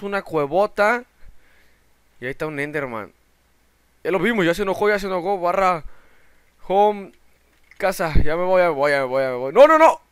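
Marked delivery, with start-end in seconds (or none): 4.0-9.5 loud, high hurried panic; panicked fleeing. Real, not acted. Gamer fleeing an angry Enderman, panicked and loud. 9.5-10.0 high, loud screamed no; panicked scream. Real, not acted. Gamer about to die in the game, screaming in panic.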